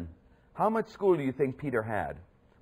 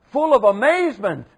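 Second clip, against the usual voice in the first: high, loud burst of wonder; amazed; 10 semitones higher, 11 dB louder than usual. Acted. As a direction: let the awe spill over. Crowd marvels.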